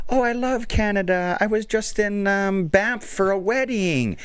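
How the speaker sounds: high-pitched chatty lady, imitated; gushing older lady. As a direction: imitate a lady delighted to meet Canadians. Acting the older Italian lady.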